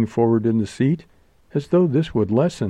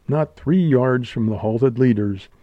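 usual speaking voice

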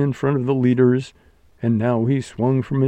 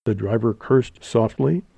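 ordinary delivery, nothing marked

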